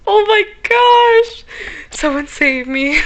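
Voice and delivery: high, loud, slow frightened shriek; scared horror game streamer. Real, not acted.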